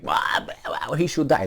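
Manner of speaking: spluttered wows, then pitch jumps up; mock outraged reaction. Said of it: "see how they're gonna be like".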